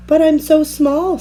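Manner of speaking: put-on a little girl shrinking; Nell's small plea. A narrator's reading. Nell doubting herself.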